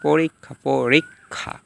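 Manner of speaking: পরীক্ষা as "porik-kha"; k-kh sound. Shown as a demonstration. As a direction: split পরীক্ষা before a long "kha". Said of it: "কক".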